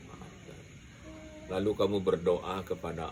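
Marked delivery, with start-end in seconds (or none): 0.1-0.6 whispered. Shown as a demonstration. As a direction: whisper the word Maafkan, no voice at all. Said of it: "Maafkan kesalahan istri dan anak". (bisikkan Maafkan tanpa suara)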